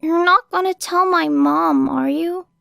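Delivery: put-on high, pleading child voice; worried young kid. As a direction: act the scared runaway. Playing the scared kid.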